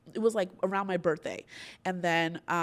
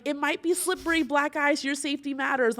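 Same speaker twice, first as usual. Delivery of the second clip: a hint of a cautious agent, pitched higher; mock worried agency; 7 semitones higher, 4 dB louder than usual. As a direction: lightly play the nervous booker warning her. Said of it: "they're like".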